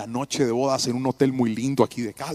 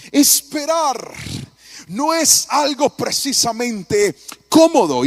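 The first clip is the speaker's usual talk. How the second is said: "Esperar" slow, higher and louder; emphatic key word; 8 semitones higher, 6 dB louder, slower than usual. Acted. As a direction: pastor stresses "Esperar" to the church, slow and loud.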